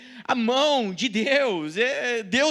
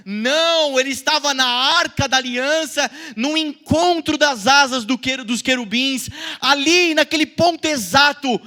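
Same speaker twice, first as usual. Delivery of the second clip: loud preaching; 4 dB louder than usual. Real, not acted. Preacher building to climax.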